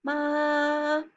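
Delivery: long "Ma" on one high, level pitch; monotone, high, sustained "Ma"; first tone. Shown as a demonstration. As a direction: hold "Ma" high and steady to the end. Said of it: "maintain the pitch level from the beginning to the end".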